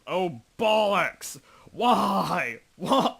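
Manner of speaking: high, loud, slowed shocked cry; jolted by a scare, blurt it; startled outburst. Real, not acted. Gamer jolted by a sudden scare, shocked cry.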